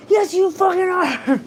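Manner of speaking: high, loud, slow mock yell; reenacting yelling at Carter to help, high and loud; retold yell. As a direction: bark the demand at him again, drawn out. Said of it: "and I yelled at him".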